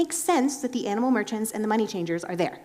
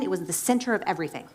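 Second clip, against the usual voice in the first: ordinary talk, nothing marked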